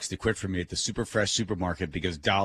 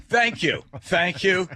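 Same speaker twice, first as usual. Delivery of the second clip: gleeful thanks; 7 semitones higher, 4 dB louder, slower than usual. Retold or imitated. Grateful after being fired.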